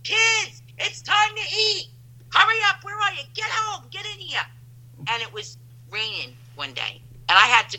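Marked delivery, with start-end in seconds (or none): 0.0-4.4 put-on high-pitched holler for the kids; hollering up the block for dinner; imitated mother's yell. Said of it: "you would hear yell".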